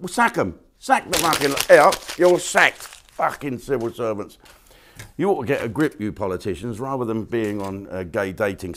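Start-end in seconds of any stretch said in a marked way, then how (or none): 0.1-6.8 loud, high, scornful ranting at politicians; angry rant. Real, not acted. Ranting at politicians about civil servants, loud and scornful. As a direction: shout it with contempt.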